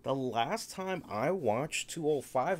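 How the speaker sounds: usual speaking voice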